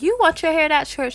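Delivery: high-pitched mock disbelief; shocked question. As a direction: ask it as if you can't believe her choice. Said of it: "I was really shocked".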